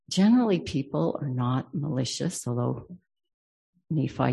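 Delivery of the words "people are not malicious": usual speaking voice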